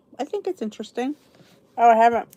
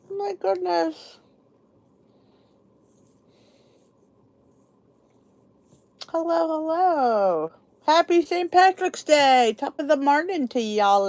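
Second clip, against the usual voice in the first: playful Irish greeting; 6 semitones higher than usual. Pretend. Saint Patrick's Day greeting to viewers.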